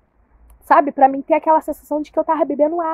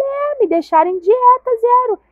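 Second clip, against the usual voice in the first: high complaint; 10 semitones higher than usual. Retold or imitated. Telling doctor she hasn't eaten.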